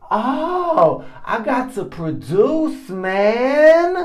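high-pitched, drawn-out comic wail; mock panic. Man retelling pressure to impress his revered mentor, mock panic. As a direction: whine it high, stretching out the pressure.

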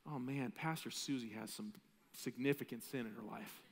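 low hushed gossip under the breath; muttering to yourself. Retold or imitated. Quietly judging a fellow church member's sin. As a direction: mutter it privately, like a judgmental aside. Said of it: "you're like".

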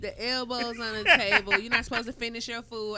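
laughing while listing rules; laughing, loud. Real, not acted. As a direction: laugh through the words. Laughing over table manners.

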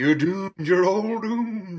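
slow ominous doom warning with a laugh; hammed-up doomsayer, drawn out; Crazy Ralph. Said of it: "Crazy Ralph from Friday the Thirteenth".

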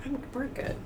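high, soft acted reassurance; small, high voice insisting all is fine; downplaying reassurance. Wife letting it build up, downplaying reassurance. Acting out holding feelings in.

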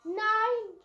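loud, high frustrated cry; frustrated shout. Real, not acted. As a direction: yell in annoyance. Kann nicht mehrere nehmen. (frustrierter Aufschrei)

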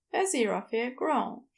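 usual speaking voice

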